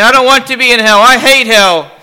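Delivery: loud, high, frantic begging; desperate pleading. A narrator's reading. Damned man begging to escape hell, desperate. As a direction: beg wildly to be let out. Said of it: "gasps the man".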